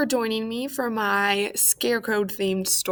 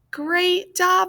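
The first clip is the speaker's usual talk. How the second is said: plain reading voice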